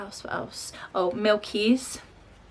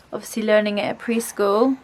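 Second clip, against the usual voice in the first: ordinary talk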